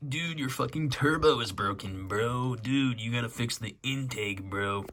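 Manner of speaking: a hint of a dumb car guy; bro voice. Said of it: "Jesse's like".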